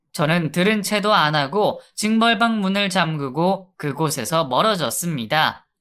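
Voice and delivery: plain reading voice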